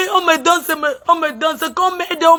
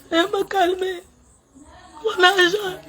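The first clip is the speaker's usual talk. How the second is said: quiet weeping; 3 semitones higher, 5 dB quieter than usual. Real, not acted. Weeping quietly, calming herself.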